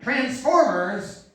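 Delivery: high, drawn-out mocking kid voice; scornful playground girl. Girl taunting a boy at play with his toys, mocking. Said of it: "a girl came up to me and told me".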